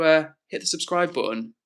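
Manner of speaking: ordinary delivery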